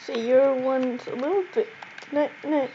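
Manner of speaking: usual speaking voice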